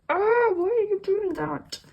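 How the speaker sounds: imitating the querent's pleading; mock whine. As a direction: plead like a hurt lover, hammed-up. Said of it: "And you're like".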